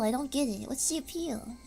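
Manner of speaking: ordinary talk, nothing marked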